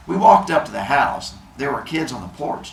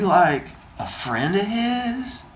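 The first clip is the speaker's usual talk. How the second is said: hippie voice; 8 semitones higher, slower than usual. Acted. Hippie asks, put-on voice.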